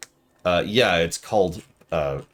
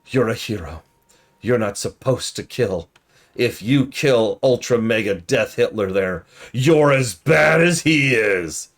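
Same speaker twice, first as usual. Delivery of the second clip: preachy scold; 4 semitones higher, 5 dB louder, slower than usual. Retold or imitated. Objector lecturing hero.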